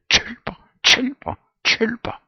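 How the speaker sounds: very high, loud shrieked insult; shrill screaming repetition; screaming in his face. Acted. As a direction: scream it right at him. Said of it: "skriger ham ind i ansigtet".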